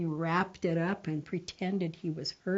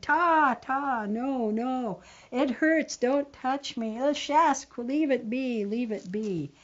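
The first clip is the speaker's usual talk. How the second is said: higher and louder, mock panic fading at the end; hammed-up don't touch me cry; panicky protest; 6 semitones higher, 5 dB louder than usual. Brother keeping sister from his bandaged leg, mock panicked shout.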